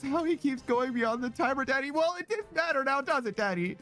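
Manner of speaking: high cutesy teasing voice; breathy cutesy giggly. Pretend. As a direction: coo each daddy playfully. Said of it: "You just like saying it".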